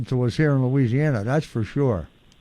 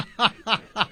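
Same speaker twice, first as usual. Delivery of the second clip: quick amused chuckle; short laugh; 4 semitones higher, 6 dB quieter than usual. Real, not acted. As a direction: laugh off the joke. Laughs at the phone joke.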